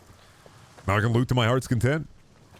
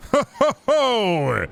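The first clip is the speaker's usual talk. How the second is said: high gloating ho ho ho; 11 semitones higher, 4 dB louder, much slower than usual. Acted. Laughs at kill.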